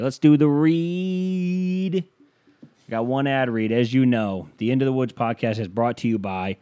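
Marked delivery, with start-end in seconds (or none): 0.0-2.0 higher, very slow hype call; mock game-show start to the ad; drawn-out announcement. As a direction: stretch it out like a showman. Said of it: "Let me get an ad read for you".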